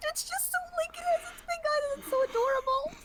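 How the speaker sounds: high excited gush; overwhelmed by cuteness. Real, not acted. Gushing about adorable eyes.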